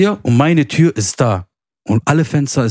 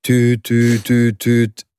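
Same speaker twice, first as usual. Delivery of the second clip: mimicked dial tone beeps; phone ring imitation; much slower than usual. Calling his mother, mimicking the phone ringing.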